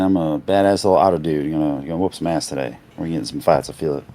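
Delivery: low laid-back otter dude. Acted. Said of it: "dialed into Thelonious today".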